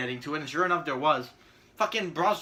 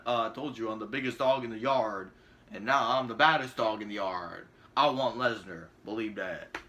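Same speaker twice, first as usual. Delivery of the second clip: mock tough wrestler, much lower and softer; lowered, smug tough-guy brag; Roman Reigns impression; 8 semitones lower, 3 dB quieter than usual. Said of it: "he's gonna come out tomorrow and be like".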